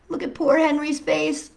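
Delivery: ordinary delivery, nothing marked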